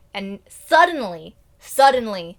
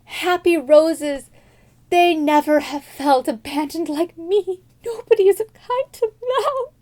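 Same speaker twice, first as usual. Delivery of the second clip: high, slow fake sob; mock crying; 7 semitones higher, 4 dB louder, slower than usual. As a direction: wail it like a hammed-up weepy princess. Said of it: "she's crying and crying".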